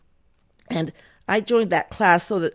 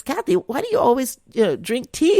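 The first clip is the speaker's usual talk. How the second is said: higher-pitched retold question; puzzled friend; 7 semitones higher than usual. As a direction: lightly imitate a friend asking, pitched up.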